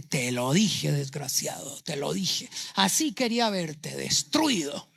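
low, spiteful I-told-you-so; bitter reproach. Acted. Wounded father sneering at his returning son, low and bitter. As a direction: sneer at the son who failed.

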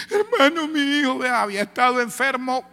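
high, tearful plea, acted out; retold desperate crying. Mother carrying her sick child, acted wailing. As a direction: imitate a distraught mother pleading for help. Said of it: "sale la hermana gritando".

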